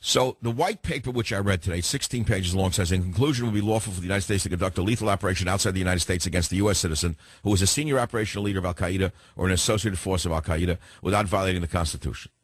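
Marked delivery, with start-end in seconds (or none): 2.9-12.3 rattling off the legal text at top speed; fast New York dialect. Real, not acted. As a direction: read it fast, no slowing down for radio. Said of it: "my normal, fast New York dialect".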